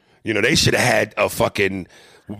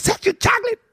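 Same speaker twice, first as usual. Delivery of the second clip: mock character voice; 3 dB louder than usual. Quoting a film line.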